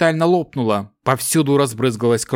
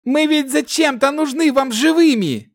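shouting; 12 semitones higher than usual. A narrator's reading. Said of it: "продолжал кричать Кирос".

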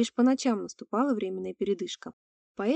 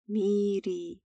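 gurgling alien voice. Acted. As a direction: rasp it like a magic-warped monster. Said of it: "Голос был мужской, протяжный и низкий".

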